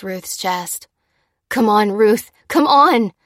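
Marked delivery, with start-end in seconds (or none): 1.5-3.1 effortful plea, rising higher and louder; laboured, urgent pleading; gasped urging. A narrator's reading. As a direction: gasp it out mid-compressions. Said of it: "she gasped".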